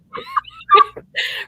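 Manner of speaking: high laugh. Real, not acted. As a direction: burst out laughing. Laughing at own accent.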